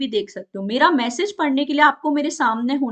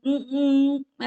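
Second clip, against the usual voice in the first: slow drawn-out hesitation hums; filler hums; 2 semitones higher, much slower than usual. Shown as a demonstration. Teacher demonstrating wordless vocal sounds, slow hesitant hums. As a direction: hum slowly as if searching for words. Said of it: "जैसे मैं बोलूँ".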